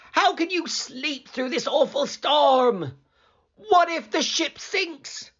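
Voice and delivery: high, frantic sailor voices; the terrified crew shaking Jonah awake; panicked sailors. A narrator's reading.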